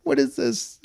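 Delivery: high, stunned disbelief; incredulous. Retold or imitated. Reacting to the feminine note, high incredulous. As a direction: squeak it out in disbelief. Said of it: "And I was like".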